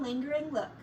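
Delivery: usual speaking voice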